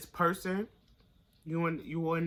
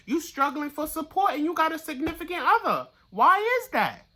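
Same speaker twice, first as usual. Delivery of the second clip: much higher and louder, pressing each question; heated indignation; 10 semitones higher, 6 dB louder than usual. Real, not acted. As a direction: demand it, indignant for them. Fed up on client's behalf.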